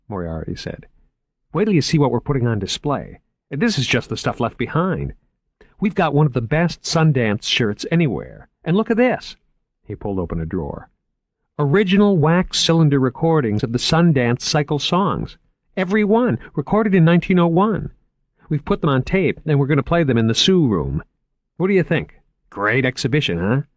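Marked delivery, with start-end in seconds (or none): 1.5-9.4 lightly voiced eager museum man, a bit higher; keen curator showing off his finds. A narrator's reading. Curator showing Margot the vaults, lightly voiced eager man. 11.6-23.6 lightly voiced eager museum man, a bit higher; keen curator showing off his finds. A narrator's reading. Curator showing Margot the vaults, lightly voiced eager man.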